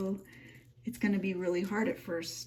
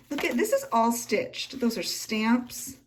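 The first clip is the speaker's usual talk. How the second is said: normal talking voice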